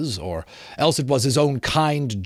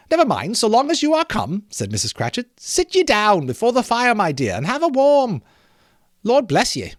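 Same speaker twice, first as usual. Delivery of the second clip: much higher, bustling mother's voice; fretful mother; 8 semitones higher than usual. Acted. Mrs Cratchit asks her children where father is, higher and fussing. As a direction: fuss like a busy mother waiting for her family.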